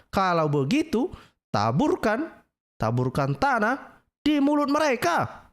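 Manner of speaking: mock solemn reading. Preacher re-reading the hadith to mock it, slow and high. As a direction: read it out again, milking each word. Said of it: "Ya, ini coba".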